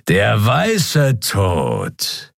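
very slow, ominous naming; menacing villain. A narrator's reading. Shadow skrier greeting Keru menacingly, very slow. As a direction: savor the name like a threat. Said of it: "begann der Schattenskrier".

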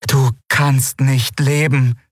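high, very slow, otherworldly lilt; strange inhuman voice. Acted. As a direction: ask like a melodic alien creature. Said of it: "sagte das Wesen auf seinem Rücken".

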